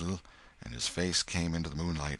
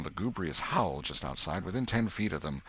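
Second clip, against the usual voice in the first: plain reading voice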